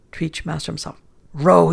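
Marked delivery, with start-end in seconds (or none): 1.3-1.7 loud, firm one-word order; captain commanding the sailor to row. A narrator's reading. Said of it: "he commanded".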